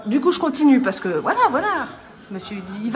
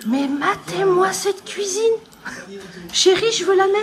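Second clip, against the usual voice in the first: amazed; 10 semitones higher, 4 dB louder than usual. Real, not acted. Émerveillée devant la cuisine.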